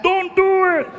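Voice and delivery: high, imitated warning cry; calling from backstage. Martin behind the curtain warning the comic, high mock call. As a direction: call out a pleading warning from afar. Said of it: "I can hear him in the back".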